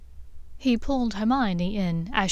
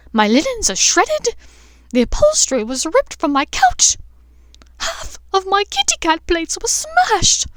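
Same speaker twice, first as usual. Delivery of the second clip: much higher and louder, a furious frantic rant; frantic annoyed tirade; 9 semitones higher, 8 dB louder than usual. A narrator's reading. Umbridge scolding Draco before the whole exam room, high furious rant. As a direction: scold at full tilt, spiralling up in outrage. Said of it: "her frantic and annoyed tone".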